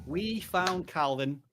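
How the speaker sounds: slow and clear, each word spaced out; slow dictation. Shown as a demonstration. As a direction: spell it out slowly for typing. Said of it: "So if you type that in".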